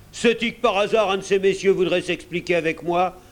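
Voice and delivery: booming pirate challenge; thundering voice. A narrator's reading. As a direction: dare them to answer back, forcefully. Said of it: "d'une voix tonnante".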